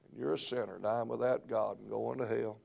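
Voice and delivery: ordinary talk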